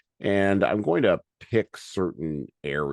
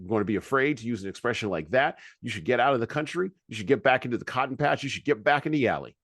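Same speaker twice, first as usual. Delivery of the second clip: higher, emphatic rally-speech delivery; oratorical speech reading; 6 semitones higher, faster than usual. Acted. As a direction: drive the argument home as if addressing a rally. Reads Malcolm X speech aloud.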